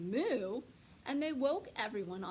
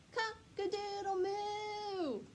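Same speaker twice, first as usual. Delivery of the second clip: high, slow drawn-out moo-call; cow mooing a crow; 11 semitones higher, much slower than usual. Acted. Cow bellowing its attempt at cock-a-doodle-doo. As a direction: stretch it out like a big cow trying to crow.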